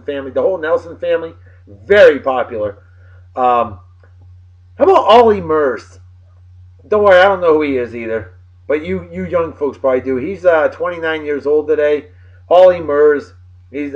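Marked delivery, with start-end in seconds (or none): none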